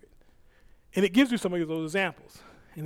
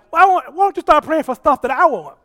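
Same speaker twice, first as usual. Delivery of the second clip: higher and louder, pressing the question; Holy Spirit's voice; 9 semitones higher, 11 dB louder than usual. Retold or imitated. Holy Spirit urging the preacher to pray his will, loud and high. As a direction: ask it with divine insistence. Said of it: "But he was like".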